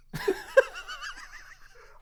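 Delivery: big high laugh at the punchline; high burst of laughter. Real, not acted. Host cracking up at his own Shaq story, high laugh.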